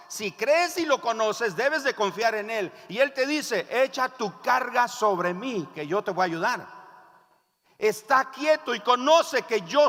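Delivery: loud, high, emphatic sermon delivery; forceful preaching. Real, not acted. Pastor urging the congregation to trust God, forceful. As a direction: drive each phrase home to the congregation.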